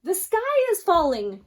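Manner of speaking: high, loud panicked cry; crying out in alarm. Acted. Hen crying to the fox, high and loud. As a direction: shriek the news again in panic. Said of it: "cried Henny Penny".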